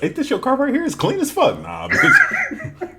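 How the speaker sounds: high-pitched imitation of a questioning friend; high retold voices with laughter; mock exchange. Acts out friend and his refusal.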